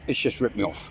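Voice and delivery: usual speaking voice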